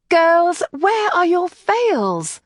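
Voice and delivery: high, slow scolding question; chiding. Acted. Kathleen scolding the twins about their veils, chiding. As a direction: gently scold the unruly girls. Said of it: "asked in a chiding tone".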